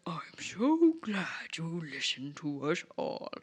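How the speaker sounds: whispered, creepy and pleased; sinister whisper. Retold or imitated. Necromancer welcoming his new pupil in a sinister whisper. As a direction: hiss it like a scheming mentor.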